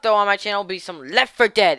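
ordinary talk, nothing marked

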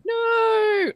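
long, high, loud mock wail; hammed-up cry of despair; mock anguished cry. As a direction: wail a drawn-out no, imitating movie despair. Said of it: "basically crying, like".

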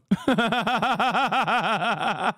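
a high whooping laugh rising up; laughing shout. Real, not acted. Streamer laughing at his own meme, high whooping laugh.

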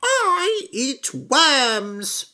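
very high, very slow, proud; chicken voice. A narrator's reading. Chicken telling frog she eats worms, very high. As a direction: hen savouring the word worms. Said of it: "said the chicken".

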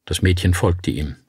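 ordinary delivery, nothing marked

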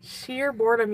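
ordinary talk, nothing marked

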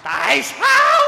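high, loud furious cry; outraged shout. Acted. Father shouts at the pair who tricked him. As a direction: explode at being tricked.

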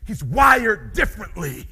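slow, loud, driven declaration; like a true hunter. Shown as a demonstration. Showing the audience the hunter's way, slow and loud. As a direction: declare it with full conviction. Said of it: "I'm gonna show you".

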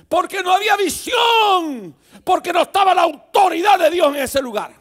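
preaching at full force. Acted. Pastor rebukes the church over the golden calf, shouting.

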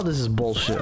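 usual speaking voice

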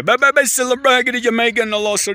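high, rushing mock Jamaican patter; Jamaican accent imitation. Vlogger showing viewers how Jamaicans talk, mock Jamaican accent. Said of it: "kind of an accent whereby".

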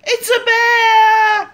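high, slow, frightened yell; scared shout. Acted. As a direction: cry out in terror at the bear. Sees the bear, shouts.

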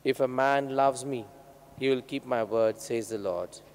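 ordinary talk

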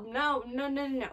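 ordinary talk

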